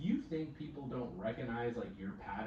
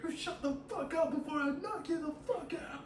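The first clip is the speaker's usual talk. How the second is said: high-pitched hot-headed threat imitation; mock furious threat. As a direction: retell a threat as a high, heated outburst. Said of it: "the guy turned and looked at her".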